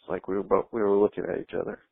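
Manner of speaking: normal talking voice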